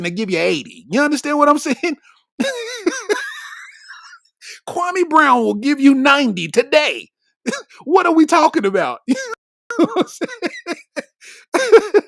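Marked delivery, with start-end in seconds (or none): 2.4-3.5 a quick high laugh. Real, not acted. Laughs at his own Richard Jefferson joke. 9.1-12.0 laughing. Real, not acted. Laughing, loud exclamation.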